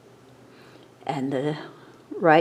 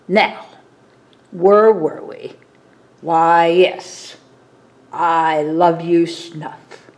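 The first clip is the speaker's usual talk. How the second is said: a hint of a dragon, a bit louder; lightly voiced dragon; 5 dB louder, slower than usual. Dragon librarian resumes reading to the children, lightly voiced. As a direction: read like a gruff dragon softening.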